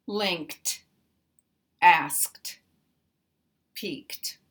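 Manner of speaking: Linked ending in a crisp kt; T sound ending. Shown as a demonstration. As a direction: finish asked and peaked with a clear T. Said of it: "will also have a T sound".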